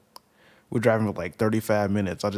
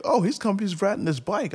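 much higher, lightly voiced relatives; relatives answering; 10 semitones higher than usual. Relatives telling the kid the uncle rides along, casual. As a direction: toss off the answer like it's nothing. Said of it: "they're like".

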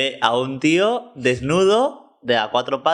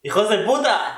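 angry outburst; 8 semitones higher than usual. Real, not acted. Outburst at electric company.

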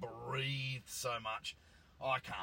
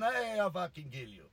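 louder mock Italian threat, friendly; Italian accent; 5 semitones higher, 5 dB louder than usual. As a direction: threaten with a grin, Italian style. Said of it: "Italian guy with quite a thick accent".

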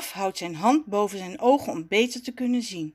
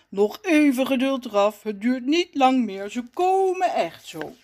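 lightly voiced patient figure, a little louder; patient reassurance; 2 semitones higher, 4 dB louder than usual. A narrator's reading. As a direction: reassure an impatient child. Rommes calms impatient Raf. (geduldig sussend, licht aangezette stem)

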